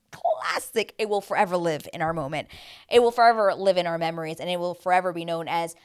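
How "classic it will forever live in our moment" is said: ordinary talk, nothing marked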